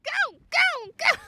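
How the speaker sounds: high, loud replay of her own yells; mock yelling at the game. Streamer reenacting her yells at the broken ball, hammed-up shout. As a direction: yell the command again, hammed up.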